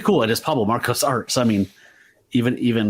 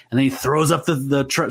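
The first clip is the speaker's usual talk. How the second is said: usual speaking voice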